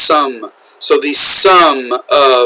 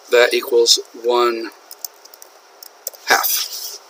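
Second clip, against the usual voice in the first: slow careful demo; 2 semitones lower, 5 dB quieter, slower than usual. Shown as a demonstration. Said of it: "be careful with your fractions".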